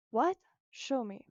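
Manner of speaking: usual speaking voice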